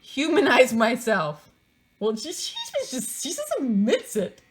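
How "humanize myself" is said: mock dramatic. Mocking the book's phrase.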